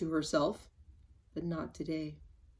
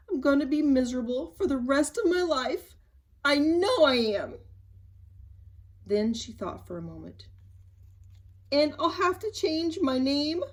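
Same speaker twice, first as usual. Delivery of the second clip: sobbing; 5 semitones higher, 4 dB louder than usual. Acted. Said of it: "she sobbed".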